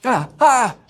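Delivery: high excited cry; excited yelp. Real, not acted. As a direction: yelp with delight. Excited exclamation at lights.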